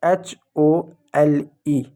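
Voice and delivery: "H O L E" slowly, letter by letter; spelling out. Shown as a demonstration. Teacher writing a word for pupils, slow letter-by-letter spelling. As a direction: spell it out slowly with a gap after each letter. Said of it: "एक शब्द मैं लिखता हूँ".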